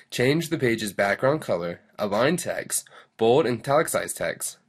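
loud, peppy tutorial read; lesson read-aloud. Acted. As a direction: read the instructions out with big lively emphasis. Reads the lesson summary aloud.

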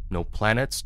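plain reading voice

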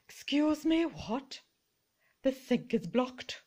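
lightly voiced indignant lady, hands on hips; sassy lady voice. Voicing the hands-on-hips lady figurine, indignant. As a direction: play a huffy housewife.

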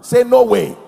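loud, forceful shouted command; preacher's rousing shout; congregational shout. Real, not acted. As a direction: shout it out to rally the crowd. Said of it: "Shout it again".

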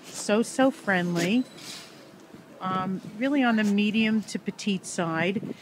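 ordinary talk

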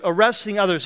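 normal talking voice

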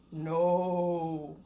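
low, gruff animal answering; a grumpy creature says no. Acted. Animal behind the door tells the kids no, low and gruff.

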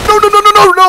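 gasp, then high, loud frantic screams of no; terrified shrieking no, no, no; panicked screams. Real, not acted. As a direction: scream no in real panic as they catch you.

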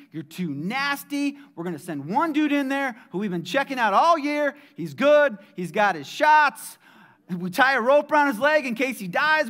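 loud, high, driving sermon climax; preaching at full force. Acted. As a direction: preach it hard, mocking the old temple rules.

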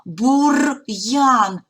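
ordinary delivery

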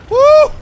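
high loud whoop; victory whoop. Real, not acted. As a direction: whoop in relief. Reaching the cover.

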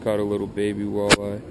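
ordinary delivery

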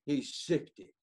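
normal talking voice

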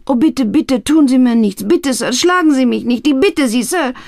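high, loud terrified begging; pleading. A narrator's reading. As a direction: beg for mercy, terrified. Old man begging not hit.

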